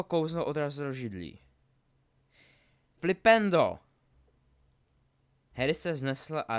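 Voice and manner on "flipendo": high, explosive battle cry; shouted spell. Acted. Draco casts at Harry in the duel, shouted spell. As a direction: blast the spell out loud.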